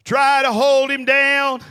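ordinary delivery

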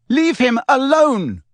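shouting. Acted. Lily shouts at the boys.

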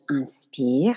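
inspire, said the French way; French pronunciation. Shown as a demonstration. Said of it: "that we say breathe in in French is".

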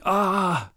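loud, high "ah" of pain; sharp high yelp, acted; acted cry of pain. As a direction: cry out as if suddenly hurt. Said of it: "hears a grunt of pain".

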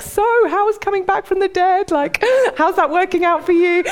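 high, over-bright mock small talk; hammed-up breezy chatter to dodge the awkward topic; jazz-hands cheeriness. Peter making small talk with risen Jesus, hammed-up cheery. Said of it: "I would do my best jazz hands".

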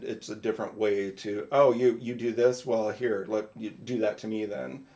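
normal talking voice

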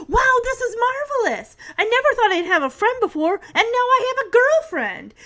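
high-pitched, gushing excitement; mock delight. Walter imagines he has a girlfriend, overjoyed. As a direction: imitate a boy thrilled beyond belief.

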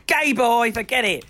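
high-pitched mock dismissal; mock dismissive outburst. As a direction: wave him off in an exaggerated high voice. Acting out dismissal of crush.

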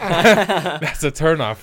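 loud, slow laughing punchline. Real, not acted. Joking about his turn-off list, laughing.

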